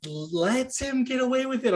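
normal talking voice

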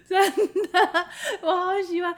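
high, loud laughing words; giggling through the words. Real, not acted. Friend delighted by the story, laughing loudly.

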